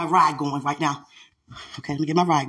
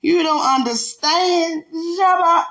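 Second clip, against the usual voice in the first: excited; 11 semitones higher, 6 dB louder, slower than usual. Real, not acted. Playful teasing outburst.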